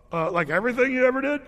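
high, loud, fast worried question; mock panicked townsman; anxious men checking; nervous disbelief. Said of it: "the men in the town are going".

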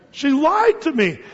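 betrayed passenger. Acted. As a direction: say it again just as hurt. Duped passenger repeats.

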